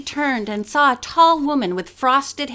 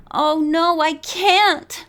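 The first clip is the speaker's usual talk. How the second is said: moaning; 4 semitones higher, slower than usual. A narrator's reading. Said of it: "Bess moaned".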